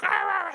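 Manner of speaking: loud, very high mock wail; hammed-up wordless sobbing; imitated bawling. Imitating daughter's heaving sobs.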